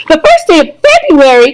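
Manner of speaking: cried out. A narrator's reading. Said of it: "cried Missus Bucket".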